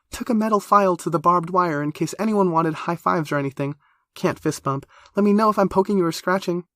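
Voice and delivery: put-on high, quick, chatty young woman; eager high girl voice. A narrator's reading.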